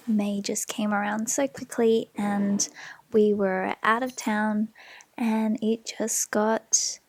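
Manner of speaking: usual speaking voice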